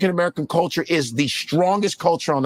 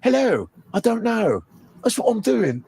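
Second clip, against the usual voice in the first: put-on British accent, a touch higher; 3 semitones higher than usual. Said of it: "when you have a British accent".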